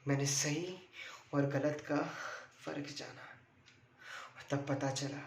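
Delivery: subdued and soft, with halting pauses; choked-up recollection. Real, not acted. Son telling audience of his father's death, subdued and emotional. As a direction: hold back emotion, speaking softly.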